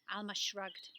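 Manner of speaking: ordinary delivery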